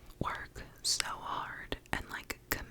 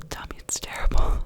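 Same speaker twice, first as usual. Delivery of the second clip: laughing plea; 15 dB louder than usual. Real, not acted. Jokingly asks for honest feedback.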